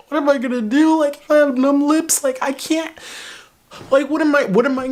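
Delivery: high panicky wail; frantic whine to friends; panicked high voice. Retold or imitated.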